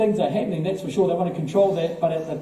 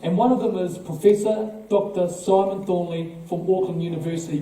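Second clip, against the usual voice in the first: normal talking voice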